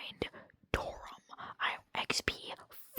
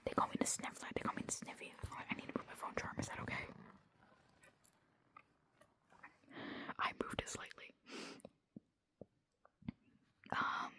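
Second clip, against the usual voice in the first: whispered vlog. Real, not acted. Rambling to listeners about the day at dead of night, whispered. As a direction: whisper casually, as if thinking aloud.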